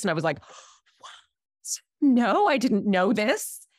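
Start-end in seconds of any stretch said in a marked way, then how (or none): 0.4-1.8 hushed, voiceless gasp of disbelief; shocked whisper. Retold or imitated. Niece learns it is her aunt's birthday, shocked whisper. 2.0-3.7 high, drawn-out protest; flustered surprise. Retold or imitated. Niece caught forgetting her aunt's birthday, flustered.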